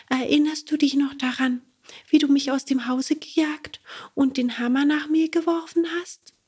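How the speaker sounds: high unvoiced whisper; reproachful whisper. Cricket reminds Bengele of the hammer, whispering. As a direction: hiss the old wrong back at him.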